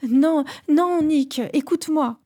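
high, frantic begging; desperate pleading. Acted. Nathalie to her fiancé, begging him to listen, frantic and high. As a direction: plead as if he is slipping away.

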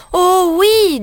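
high, bright burst of "oui"; eager exclamation. Acted. Little girl eagerly answering the wolf, excited. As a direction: jump in eagerly.

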